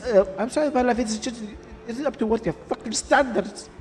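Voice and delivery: acted family quarrel. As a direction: voice both sides of a family fight. Voices the escalating fight.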